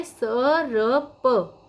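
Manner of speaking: स र प slowly spelled out, र before प; sound by sound. Shown as a demonstration. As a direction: say स, र, प as separate drawn-out sounds. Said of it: "ऐसे पढ़ना है".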